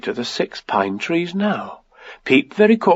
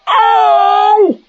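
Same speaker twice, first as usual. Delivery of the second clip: high, loud, drawn-out "oh" wail; 6 dB louder than usual. A narrator's reading. Pooh stuck in the honey jar, loud wail. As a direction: howl from inside a jar. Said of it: "he said inside the jar".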